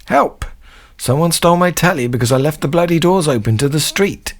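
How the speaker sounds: high-pitched panicked wail; mock cry for help. Singer robbed through his open doors, high-pitched mock distress. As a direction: cry out as a hammed-up burglary victim.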